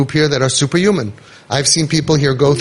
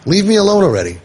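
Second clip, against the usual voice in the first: mildly snappish, pitched higher; higher-pitched, understated irritation; irritated snap; 5 semitones higher than usual. A narrator's reading. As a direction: snap lightly, as a narrator voicing annoyance. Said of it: "snapped Avremi".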